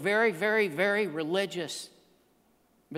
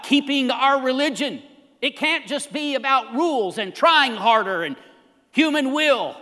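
louder, emphatic preacher delivery; impassioned preaching; 5 semitones higher, 8 dB louder than usual. Real, not acted. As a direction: drive each point home to the congregation. Preaches with force.

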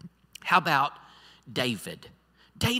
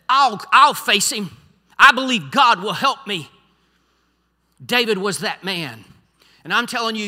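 firm, raised declaration of faith; rousing preaching; 4 semitones higher, 8 dB louder than usual. Acted. Preacher voicing someone stepping up in faith, rousing. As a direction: declare it boldly from the pulpit.

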